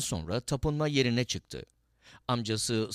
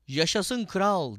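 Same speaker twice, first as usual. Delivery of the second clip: high, drawn-out cheer; crowd's acclaiming shout; 8 semitones higher, 4 dB louder, slower than usual. A narrator's reading. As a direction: proclaim it slowly, pitched well up. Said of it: "diye bağırdı".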